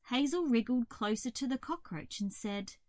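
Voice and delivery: plain reading voice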